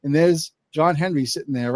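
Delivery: ordinary talk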